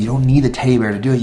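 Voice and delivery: ordinary talk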